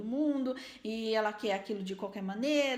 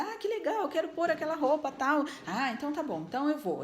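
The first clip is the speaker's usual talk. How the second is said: put-on much higher, louder delighted kid voice; excited child; 5 semitones higher, 4 dB louder than usual. Child picking her favourite outfit, voiced high and delighted. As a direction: squeal it like a thrilled little girl. Child happy about choosing clothes.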